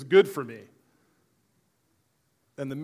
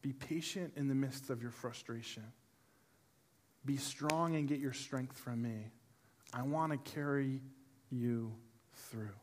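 soft, quiet reassurance; God reassuring the tired listener, softer; gentle voice of God; 3 semitones lower, 8 dB quieter than usual. Acted. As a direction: speak comfort softly, as God. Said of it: "a God who is saying".

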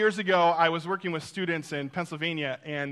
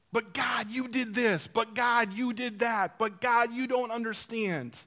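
mock protest; 4 semitones higher than usual. People complaining to Jesus, mock screaming protest. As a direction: protest in short, repeated, raised-pitch bursts. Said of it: "we're screaming at the very person who can help us out".

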